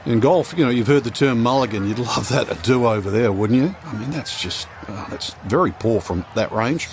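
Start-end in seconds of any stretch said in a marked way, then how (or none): none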